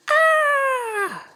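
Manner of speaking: high shriek then a cackle; playful witch shriek. Acted.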